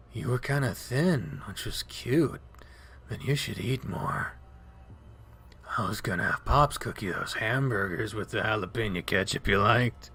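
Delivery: lightly voiced drowsy wolf; dazed wolf voice. Leo's voice, dazed and low.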